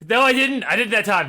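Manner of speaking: voice cracking. Real, not acted. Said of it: "My voice just cracked".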